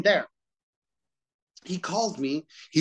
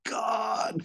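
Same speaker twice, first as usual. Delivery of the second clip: drawn-out disbelief; 7 semitones higher, much slower than usual. Retold or imitated. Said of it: "I'm like".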